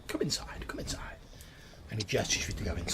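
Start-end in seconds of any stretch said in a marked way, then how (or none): 0.1-1.2 whispered invitation. Acted. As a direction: whisper come inside, voiceless. Tailor beckons customer inside secretively.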